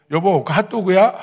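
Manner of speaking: loud, oblivious husband's question; clueless eager question. Retold or imitated. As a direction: ask your wife brightly, missing the mood entirely. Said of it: "제가 눈치도 없이". (눈치 없이 큰 소리로 묻기)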